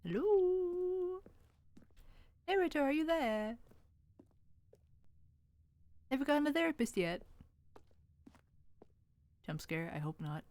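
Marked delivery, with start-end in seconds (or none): 0.0-7.2 high-pitched call to someone gone silent. Real, not acted. Calling to the silent narrator.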